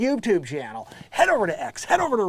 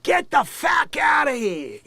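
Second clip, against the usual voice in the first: loud, higher mock Boston tough talk; Boston attitude; 8 semitones higher, 4 dB louder, slower than usual. Comic doing Hilaria's Boston attitude, loud and higher. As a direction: bark it like a Bostonian. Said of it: "that Boston attitude".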